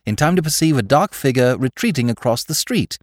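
ordinary delivery

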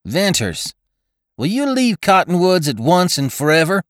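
taut, pitched high, pressing hard; a tense elder pressing the rider. A narrator's reading. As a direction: demand it with strained nerves. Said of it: "asked Tull tensely".

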